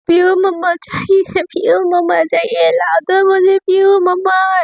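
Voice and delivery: put-on high, slow tearful little-girl whine; whining child. Little Raha clings to Pragya's legs, tearful child whine. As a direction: wail for mama like a small child. Child crying for her mother.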